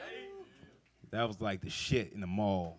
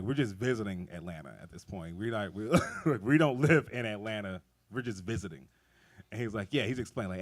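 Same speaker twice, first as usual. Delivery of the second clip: ordinary talk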